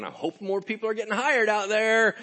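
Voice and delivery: high, loud call across a field. Acted. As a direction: holler it out like a hopeful boss. Owner calling out as he sweeps back.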